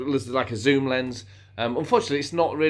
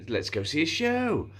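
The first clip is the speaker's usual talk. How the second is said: silly line, pitched up a little; slightly silly; 6 semitones higher than usual. Retold or imitated. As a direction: say it a touch sillier, higher at the end. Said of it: "more, slightly more silly".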